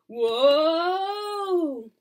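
long, high, loud whoa; falling yell. Acted. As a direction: cry out as if sliding downhill. Sliding down slippery slope.

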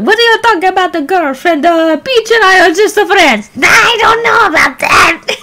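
high-pitched cartoon character voice; Toad. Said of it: "time to do Toad's voice".

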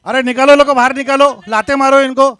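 loud, high shouted order; furious shouting. Real, not acted. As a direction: yell to have them thrown out. Ordering protesters thrown out.

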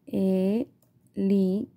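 slow, cut into syllables. Shown as a demonstration. Teacher modelling segmentation for parents, slow and syllabic. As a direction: break helicóptero into its beats. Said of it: "vamos a ir segmentando".

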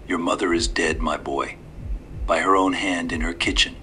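a hint of false grief from an old man; sorrowful old man. A narrator's reading. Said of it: "he said in sorrowful tones".

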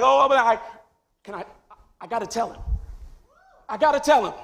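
bursting to speak, barely holding back; Jesus eager and tearful. Retold or imitated. Jesus in heaven, desperate to call out to Abraham. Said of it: "And Jesus is like".

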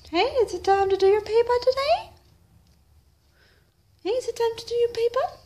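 high, quick, eager question; excited pet talk. Real, not acted. Asking the pet to do a task, excited. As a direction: hype up the animal with a fast question.

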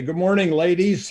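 sarcastic mock-polite hello; mocking greeting. Gym owner mocking the men.

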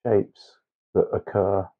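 ordinary talk

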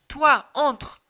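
you go, loud and pressing; urging push. Acted. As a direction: push the job onto the other one. Shepherds push each other in.

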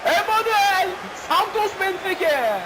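drawn-out high stadium announcer cry; slow, high announcer roar; announcer name call. Pretend.

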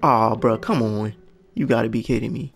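ordinary talk

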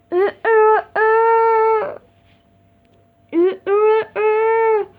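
high loud beeping alarm noise; imitated alarm beeping. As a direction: beep like an alarm clock. Toy alarm sound imitated.

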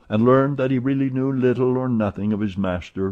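ordinary delivery, nothing marked

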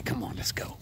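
soft whispered coaxing; whispering. Real, not acted. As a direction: whisper the dog on.

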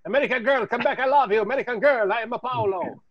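high, hammed-up Italian-accented broken English; Italian kids' accent. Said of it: "he sounded like those Italian kids".

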